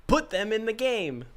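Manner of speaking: wise man quote. Retold or imitated. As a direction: deliver it like a lofty sage. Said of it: "as a wise man once said".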